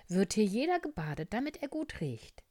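high, brisk nurse voice; nurse's instruction. Acted. Nurse Luzi explains bathing. (hohe, flotte Krankenschwesterstimme)

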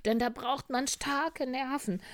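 high, loud swagger; boastful fox. Acted. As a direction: brag on in a show-off voice. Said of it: "spielte sich der Fuchs auf". (laut angeberischer Fuchs)

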